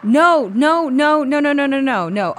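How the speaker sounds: loud, high, frantic string of nos; loud and high, begging a friend to stop a scary story; panicked protest. Acted.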